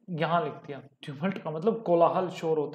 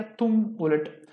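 slow memory phrase; much slower than usual. Shown as a demonstration. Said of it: "इसको हमने कैसे किया".

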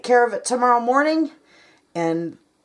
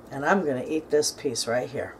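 ordinary delivery

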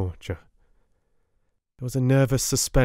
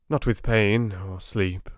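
ordinary delivery, nothing marked

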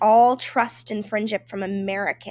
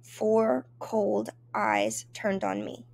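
ordinary delivery, nothing marked

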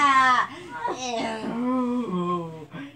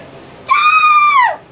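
very high shrieked stop; shrieking; 13 dB louder than usual. Real, not acted. Shrieking protest.

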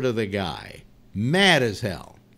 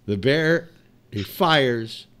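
ordinary delivery

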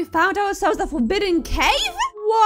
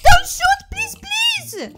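panicked surrender shout; 8 dB louder, slower than usual. Pretend. Begging again, louder and higher.

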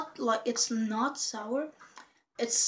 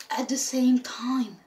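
whispering; 4 dB louder, slower than usual. Real, not acted. Candy going in mouth.

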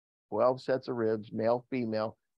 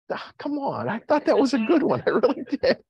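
high, loud protest breaking into laughter; laughing protest; 9 semitones higher, 4 dB louder than usual. Real, not acted. Pastor defending a flopped joke to the congregation, laughing protest. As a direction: plead your case through laughter.

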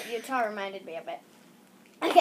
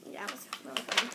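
ordinary delivery

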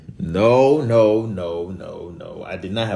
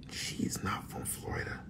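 hushed whispered aside; 7 semitones lower, 15 dB quieter than usual. Real, not acted. Whispering a dig at Siri to the viewers. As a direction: drop to a whisper.

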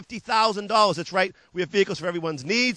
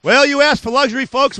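higher and louder, selling the luxury; big pitchman hook; 5 semitones higher, 8 dB louder than usual. Acted. Dealer hyping luxury to viewers, loud excited pitch. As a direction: announce it like the star of the lot.

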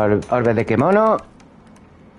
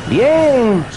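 excited cheering; 11 semitones higher, slower than usual. Real, not acted. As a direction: urge the hit on. Cheering during the fight.

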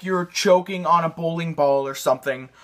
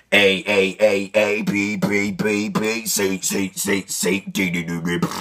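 very deep, gravelly-low letter sounds; letters in throat voice; 12 semitones lower, slower than usual. Shown as a demonstration. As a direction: growl each letter from the throat. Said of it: "try to say 'em in that voice".

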